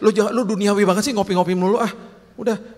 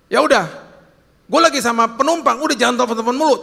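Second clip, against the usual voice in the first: louder, higher, an annoyed driver brushing off his wife; irritated snapping; 6 semitones higher, 5 dB louder, faster than usual. Retold or imitated. Driver cutting off his wife's phone call, annoyed and loud. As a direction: bark it off like a fed-up husband.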